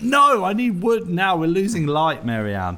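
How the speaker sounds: high, loud hammed-up outburst; mock urgent yell. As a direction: snap at her in mock panic. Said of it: "And I'm like".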